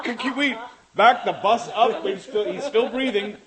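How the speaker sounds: high, loud, helpless laughing speech; laughing hard. Real, not acted. Begging to back the bus up, cracking up. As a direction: plead through uncontrollable laughter.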